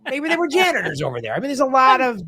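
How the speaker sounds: high, quick hedging; high-pitched doubtful voice. Shown as a demonstration. Host planting seeds of doubt, high-pitched voice. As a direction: pitch up high while floating an excuse. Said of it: "when you go high pitched".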